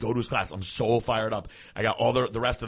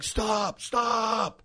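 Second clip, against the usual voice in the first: much higher sharp shouted stop; high retold shout of the teacher; 11 semitones higher than usual. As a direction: yell it like a teacher breaking up a fight. Teacher runs over shouting.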